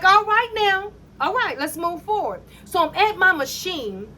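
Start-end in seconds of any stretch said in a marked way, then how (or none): 0.0-2.4 higher, quick, eager coworker voice; upbeat coworker. Retold or imitated. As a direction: rattle it off like a cheerful friend. Coworker voiced, cheering her on.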